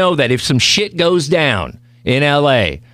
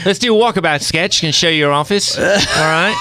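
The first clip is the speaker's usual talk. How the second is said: higher, lightly imitated foreign coworker; Jeffries; 4 semitones higher than usual. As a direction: imitate a chummy colleague's accent. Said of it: "my Jeffries impression".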